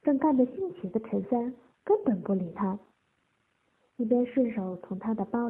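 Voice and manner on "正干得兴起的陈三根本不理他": plain reading voice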